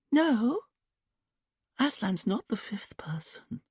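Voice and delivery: high, slow, whispered dreamy voice; trance whisper. A narrator's reading. Julia in trance answering Dominic, high childlike whisper. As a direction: breathe it out far away, like a child. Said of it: "quiet, sing-song voice".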